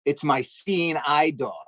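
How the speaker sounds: slow, deliberate insistence; deadpan slow claim; slow fake earnest. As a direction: lay it on slowly like it's obvious. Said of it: "I was like".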